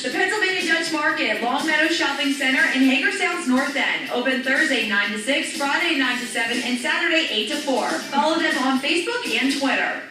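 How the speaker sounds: loud, punchy radio ad read; ad announcer read. Acted. Announcer reads store hours to listeners, loud and punchy.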